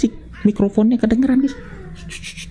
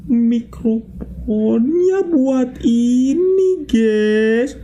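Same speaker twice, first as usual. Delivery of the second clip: very slow, high wailing drawl; long swooping, dragged syllables; drawn-out realization; 3 semitones higher, much slower than usual. Real, not acted. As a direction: stretch every vowel right out. Discovers what the microphone does.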